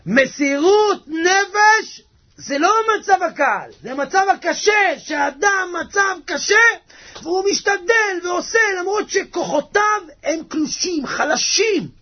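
high-pitched, impassioned sermon delivery; fervent preaching. Real, not acted. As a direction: preach it slowly at full force. Preaches at full force.

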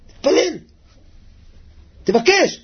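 high-pitched, emphatic commands; urgent exhortation. Real, not acted. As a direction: urge them hard to pray. Urges listeners to pray.

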